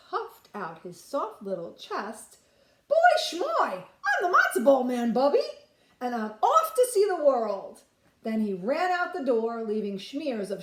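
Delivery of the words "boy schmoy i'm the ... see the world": put-on high, loud boastful kid voice; cocky matzo ball boy. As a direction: brag to grandma, chest puffed out.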